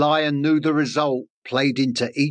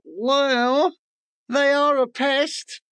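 much higher, odd bird voice; secretary bird voice; 11 semitones higher, slower than usual. Acted. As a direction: sniff at them like a haughty bird. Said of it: "Secretary Bird thought".

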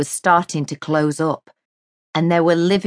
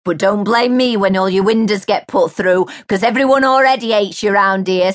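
loud threat; 5 semitones higher, 5 dB louder than usual. A narrator's reading. Mary threatening neighbour.

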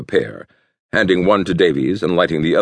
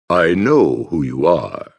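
slow, measured stentorian pronouncement; a lightly voiced hooded cult leader, slow and grand; stentorian tones; slower than usual. A narrator's reading. Cult leader answering the detective's call, slow stentorian tones. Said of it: "in stentorian tones".

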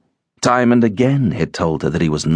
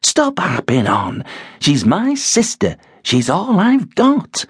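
high, slow plea; pleading wife; 11 semitones higher than usual. A narrator's reading. Wife defending her sister to Ben, high and pleading. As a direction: beg him to stop, high and slow. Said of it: "Rosa intervened".

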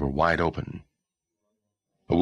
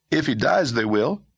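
put-on young boy voice; 10 semitones higher, 4 dB louder than usual. Boy character voice.

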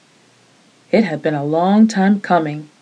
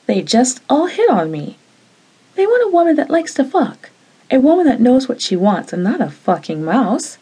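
still angry; 6 semitones higher than usual. Acted. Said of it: "but I was still angry".

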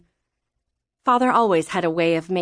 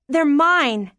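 put-on higher-pitched indignant girl; defiant child; 6 semitones higher, 4 dB louder than usual. Daughter fighting her mother for the letters, defiant. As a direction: insist on it in a raised child's pitch.

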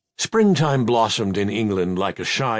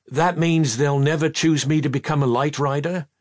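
plain reading voice

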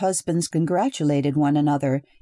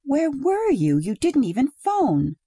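reproachful wife; 8 semitones higher than usual. A narrator's reading. Wife demanding where he was.

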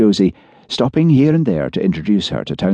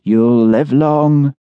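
slow, higher, louder prophecy; fortune teller; 7 semitones higher, 4 dB louder, much slower than usual. A narrator's reading. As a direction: draw the prophecy out slowly.

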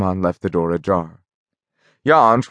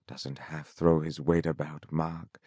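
hushed, much softer aside to a neighbour; whispered; 5 semitones lower, 13 dB quieter than usual. A narrator's reading. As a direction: lean in and whisper it under your breath.

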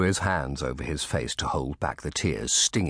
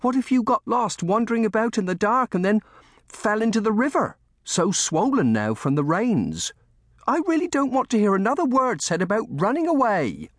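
very high, loud, fretful warning; horrified nursemaid; 4 dB louder than usual. A narrator's reading. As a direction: forbid it in alarm. Lucy imagines disaster, forbids it.

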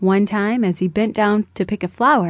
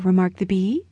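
usual speaking voice

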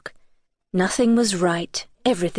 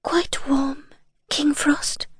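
put-on high, airy girl's whisper; gentle whisper; 5 semitones higher, much slower than usual. A narrator's reading. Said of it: "she whispered gently".

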